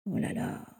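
soft breathy murmur under the breath; a whispered oh là là of dread. Acted. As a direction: mutter it almost silently. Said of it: "murmura Rémus".

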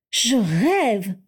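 can't believe it, blurt it out; incredulous exclaim. A narrator's reading. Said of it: "s'exclama-t-elle incrédule". (exclamation incrédule)

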